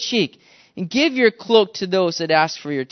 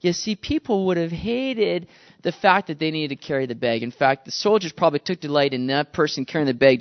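ordinary talk

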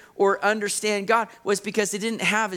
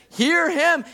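much higher, louder booming call; voice from heaven; 10 semitones higher, 5 dB louder than usual. Pretend. Imagined voice from heaven interrupting the sermon, high loud call. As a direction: proclaim it like a sudden voice from the sky. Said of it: "a voice came from heaven and said".

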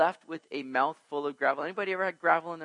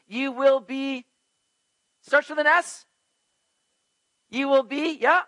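drawn-out, high prompting for the answer; coaxing prompt; 9 semitones higher, 8 dB louder than usual. Real, not acted. Preacher coaxing the congregation for the word. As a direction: fish for the word from the crowd. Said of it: "Somebody help me out".

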